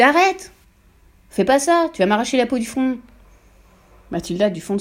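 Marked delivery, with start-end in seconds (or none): 0.0-3.0 crie très haut et fort, puis redescend un peu; cri paniqué. A narrator's reading. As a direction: supplie en hurlant qu'on arrête. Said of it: "hurla-t-il". (le père hurle à sa femme d'arrêter de tirer)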